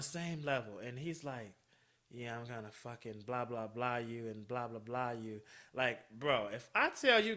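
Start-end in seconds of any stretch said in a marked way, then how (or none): 2.1-5.4 soft, slightly lowered mock dirty talk; imitating dirty talk. Caller getting dirtier, imitated softly with words blanked out. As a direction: censor his come-on with soft blahs. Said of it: "he just got dirtier and dirtier".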